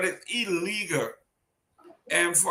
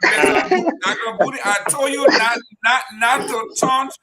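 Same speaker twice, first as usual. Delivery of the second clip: mock scolding; 7 dB louder than usual. Mock scolding a fellow panelist.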